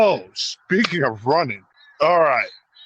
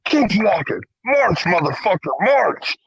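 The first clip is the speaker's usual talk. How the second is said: high-pitched, forceful marching orders; retold stern order; 6 semitones higher, slower than usual. Guard marching thief to the door, re-enacted angry command. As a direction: drive him out, drill-style.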